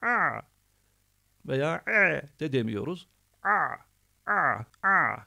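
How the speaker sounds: tight-throated ayn sound; squeezed ayn. Shown as a demonstration. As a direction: squeeze the throat for a thin strained "a". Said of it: "boğazı tam sıkarak".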